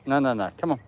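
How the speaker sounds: ordinary talk, nothing marked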